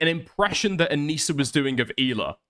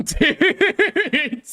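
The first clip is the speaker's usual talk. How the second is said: high, loud burst of laughter; cracking up; 5 dB louder than usual. Real, not acted. Host reacting to the impression, cracking up. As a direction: laugh helplessly through the word.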